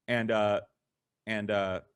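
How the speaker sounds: normal talking voice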